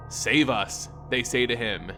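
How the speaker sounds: usual speaking voice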